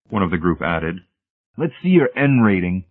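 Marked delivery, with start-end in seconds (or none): 1.5-2.8 higher, insistent demand; pitched-up official challenge. Acted. Technician demanding Hamilton's credentials, insistent.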